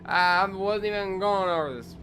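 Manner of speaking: slow, high, indignant yell at the game; indignant shout. Real, not acted. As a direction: bellow the protest at the unfair ticket. Protesting a speeding violation.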